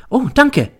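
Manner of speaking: high, thin schoolboy voice; boy's voice. Acted. Pupil voiced by teacher. (hoher, höflicher Schülerton)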